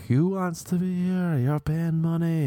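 high barking coach, slow on the money line; yelling instructor. Retold or imitated. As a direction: shout it at the class like a drill coach. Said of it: "the dude's just like".

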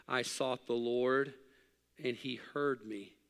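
slow emphatic reading. Acted. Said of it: "slow emphatic reading of verse".

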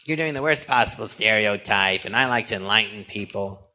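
mild impersonation of a complaint; Harvey Fierstein. Retold or imitated. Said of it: "I really imitate him good".

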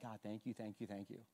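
hushed, soft thanks to God; barely above a murmur, grateful; quiet prayer. Retold or imitated. Pastor retelling his whispered thanks to God. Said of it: "I'm like".

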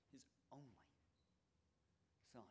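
very soft, with pauses between words; hushed and weighty, word by word. Shown as a demonstration. Preacher hushes on the gift.

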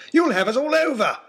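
very high, loud panicked yell; bellowing. Acted. Lord Daunt warning Oxshott the boat will tip, loud bellow. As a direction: shout the danger at him. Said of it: "bellowed Lord Daunt".

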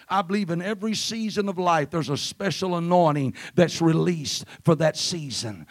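ordinary talk, nothing marked

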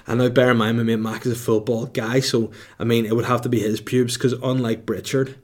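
usual speaking voice

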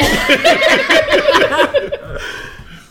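very high, loud cackle; real helpless laughing. Real, not acted. Storyteller loses his thread, real helpless high laughing. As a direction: crack up completely. Cracks up after losing his place.

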